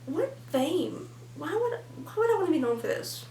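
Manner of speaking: high, fast, exasperated protest; indignant. Real, not acted. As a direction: fire back in disbelief. Victim answering accusation of fame-seeking.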